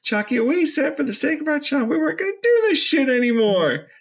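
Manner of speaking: hammed-up angry wife; mock scolding Tiffany. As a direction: scold him up high like a fed-up wife. Tiffany finds the picture.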